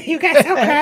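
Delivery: lightly voiced crackhead asking for crack; imitating the crackhead. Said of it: "and she goes".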